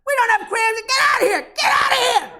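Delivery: high, loud furious madam yelling him out; madam shouting. Retold or imitated. Brothel madam yelling at customer.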